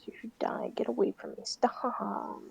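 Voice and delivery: hushed mock-hostile whisper. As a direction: whisper it like a sulky kid.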